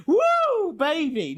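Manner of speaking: high, loud, gleeful cheer; excited whoop. Real, not acted. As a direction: whoop with glee. Excited outburst.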